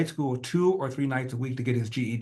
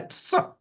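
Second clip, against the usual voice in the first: tearful laugh. Real, not acted. Emotional laugh before crying.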